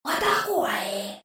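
soft, very slow ghostly whisper; a skull's hushed drawl. A narrator's reading. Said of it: "прошепотів голос". (тихий повільний примарний шепіт)

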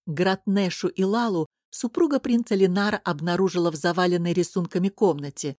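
ordinary delivery

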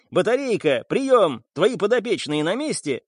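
calling out on radio. Acted. Boss calls over communicator.

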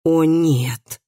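understated, moaning "oh no"; groaned dismay. A narrator's reading. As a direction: let out a mild groan of dismay. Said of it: "простонал Андреас".

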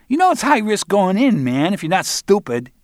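high-pitched cocky punk; cocky Barry. Acted. Barry talks down to Roland, high cocky voice. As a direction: lecture him like a know-it-all.

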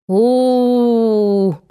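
long drawn-out "oooh" moan, held steady; mock group moan. A narrator's reading. Listeners moan for parents they care nothing about, mock moaning. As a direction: moan it like an unconvinced chorus. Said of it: "застонали они все хором".

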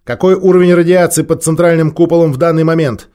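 commanding tone. A narrator's reading. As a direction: take charge and cut her off. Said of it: "Он властным тоном перебил".

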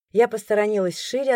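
plain reading voice

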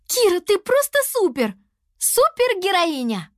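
high-pitched excited cheer. A narrator's reading. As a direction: cheer your friend on. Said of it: "Лиана поддерживала подругу". (восторженно болея за подругу)

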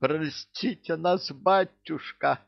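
high, slow, plaintive plea; drawn-out penitent wail; tearful girl. A narrator's reading. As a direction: beg forgiveness in a thin, weepy girl's voice. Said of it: "заплакала".

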